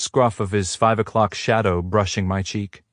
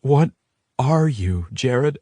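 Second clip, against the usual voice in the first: cracked, high question; voice cracking; 5 semitones higher, slower than usual. A narrator's reading. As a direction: let the voice crack. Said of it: "My voice cracks".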